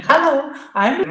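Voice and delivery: high-pitched, squeaky English voice; squeaky English accent. Retold or imitated. Storyteller imitating himself arriving in Berlin, squeaky English accent. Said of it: "this guy with a squeaky English accent".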